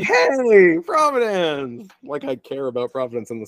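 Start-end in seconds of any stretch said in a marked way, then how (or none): 0.0-1.9 high, loud, stretched-out whoop of recognition; mock excited cheer. Podcaster mocking his own reaction to Providence, hammed-up excited shout. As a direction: call it out slowly in hammed-up delight.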